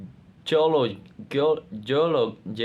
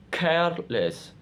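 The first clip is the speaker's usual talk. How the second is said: "careless" with CARE high and stressed, less after it; 6 semitones higher than usual. Shown as a demonstration. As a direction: split "careless" in two, hit CARE. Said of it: "Stress pattern".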